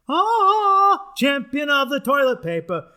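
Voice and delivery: high, shouted announcer hype; superhero hype. Pretend. Hyping himself up as Bidet Man, loud announcer voice. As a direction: belt it like a hero's entrance.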